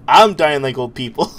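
ordinary talk, nothing marked

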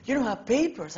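imitating his own giddy surprise; excited disbelief. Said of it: "Why are you so excited?".

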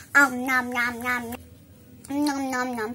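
playful pretend munching; nom nom chomping. Pretend eating toy food.

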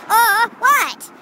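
high, loud hammed-up exclamation; big comic outburst; mock shock. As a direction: blurt it out in overdone disbelief.

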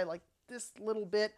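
usual speaking voice

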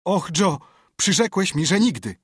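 high, loud despairing cry to Joe; anguished shout; desperate call. Acted. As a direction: cry out to the accomplice in betrayal. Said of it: "zawołał".

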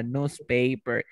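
slow "newspaper" as "noozpaper"; American pronunciation. Shown as a demonstration. Teacher repeats for student the American form, slow "newspaper" as "noozpaper". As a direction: draw out "newspaper" with a plain "noo". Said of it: "en americano?".

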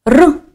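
Р rolled alone, high and loud; a short emphatic rolled Р; isolated speech sound. Shown as a demonstration. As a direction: say Р as a trilled sound, not a letter name. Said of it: "Проаналізуємо звуки".

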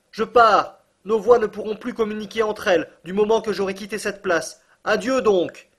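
loud high call. A narrator's reading. Axel calls goodbye to his distant uncle, loud. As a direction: shout your goodbye across the distance. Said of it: "m'écriai-je".

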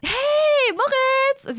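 very high, loud sing-song hello; Anthony's greeting. Pretend. Anthony calling Moritz on the phone, very high and loud. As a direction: call out his name brightly. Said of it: "Sie spricht natürlich im Dialekt".

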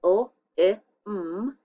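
l, i, m each letter sounded out on its own; sounding out letters. Shown as a demonstration. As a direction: spell out l, i, m one sound at a time. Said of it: "So you do the first one".

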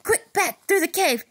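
loud urgent hurry; urgent shout. Acted. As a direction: shout it, running away. Fleeing the bear.

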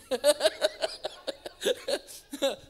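loud, high burst of laughter; real laughing fit. Real, not acted. Preacher cracking up at his own story, loud laughter.

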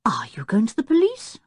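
a whispered, doubtful question; disbelieving. A narrator's reading. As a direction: ask it as if you can't believe it.

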